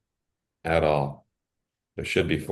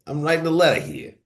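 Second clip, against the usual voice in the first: mock letter-writer voice; 8 semitones higher than usual. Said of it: "if you read it aloud, like".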